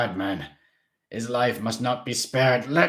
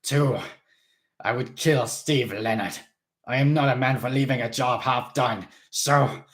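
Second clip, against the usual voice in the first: loud, raised and determined; fierce vow; 4 semitones higher, 7 dB louder than usual. A narrator's reading. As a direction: swear it out loud. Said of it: "Mister Krebsley howled".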